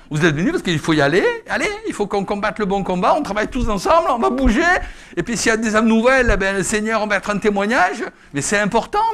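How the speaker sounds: loud, high, laughing rally call; full-force preaching. Acted. Preacher rousing his church with a loud laughing call. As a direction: whip the congregation up, loud and joyful.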